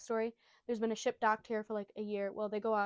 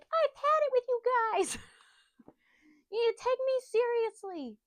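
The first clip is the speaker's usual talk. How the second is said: put-on very high, loud bratty kid voice; Luffy; 14 semitones higher, 7 dB louder than usual. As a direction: yell it like a child having a hammed-up tantrum. Acts child Luffy's tantrum.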